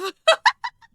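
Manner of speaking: high squealing burst of laughter; loud high laugh; shrieking laugh. Real, not acted. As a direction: squeal with delight. After the win.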